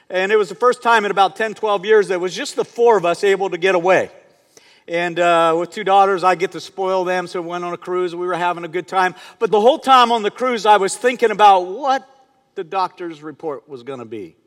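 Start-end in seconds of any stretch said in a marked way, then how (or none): none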